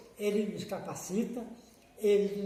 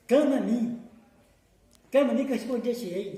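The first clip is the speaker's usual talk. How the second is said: raised, higher voice of divine call; scriptural proclamation; 4 semitones higher, 7 dB louder than usual. Acted. Preacher quotes God's call to the congregation, loud proclamation. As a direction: call it out loud and high, quoting God.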